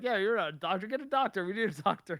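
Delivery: demanding. Retold or imitated. Son demanding a real doctor.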